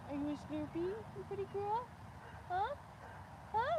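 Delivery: high, soft cooing to the cow; pet talk. Real, not acted.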